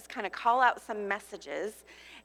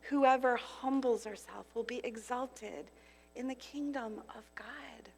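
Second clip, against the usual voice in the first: soft, fading to a whisper; heaven's gentle voice; 6 dB quieter than usual. Acted. Preacher quoting heaven's words to her listeners, softly to a whisper. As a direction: let the promise sink to a hush. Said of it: "Here's what heaven says".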